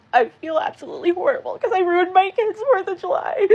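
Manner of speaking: high, breaking voice through sobs; real tearful wail; crying. Real, not acted. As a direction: cry the words out, barely holding it together. Crying over ruined holiday.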